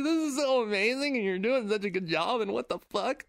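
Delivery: high, gushing retold praise; overwhelmed, almost crying. Teacher moved by a student's speech, high tearful-sounding gushing. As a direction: gush as if moved nearly to tears. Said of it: "I almost started crying involuntarily".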